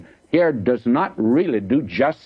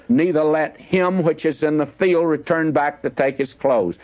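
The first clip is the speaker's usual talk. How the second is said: scripture reading; 4 semitones higher than usual. Acted. Reads scripture aloud.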